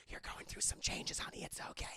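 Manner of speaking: whispered mom comfort; soft whispered reassurance; soothing whisper. Retold or imitated. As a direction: hush her like a mother. Mom soothing daughter about puberty.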